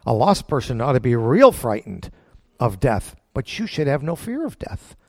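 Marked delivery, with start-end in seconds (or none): none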